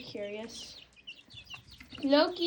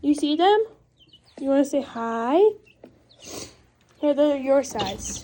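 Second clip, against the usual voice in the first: loud coaxing; 8 dB louder than usual. Real, not acted. Loud excited talk to pet.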